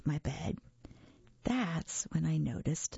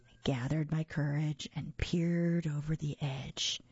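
ordinary delivery

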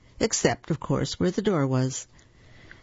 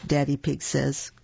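ordinary delivery, nothing marked

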